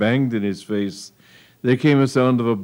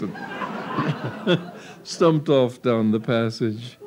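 laughing; much slower than usual. A narrator's reading. Narrator laughing, slowed.

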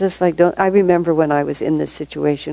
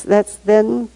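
ordinary delivery

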